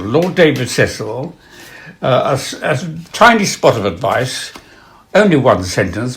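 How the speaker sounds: ordinary talk, nothing marked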